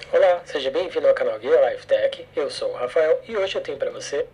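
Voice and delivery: blown-out, overdriven recording; harsh clipped playback, too loud; clipped distorted audio. Shown as a demonstration. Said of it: "uma breve demonstração do que seria um áudio estourado".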